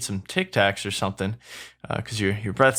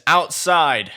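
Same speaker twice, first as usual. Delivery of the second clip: irritated; 6 semitones higher, 5 dB louder than usual. Acted. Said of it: "irritatingly says".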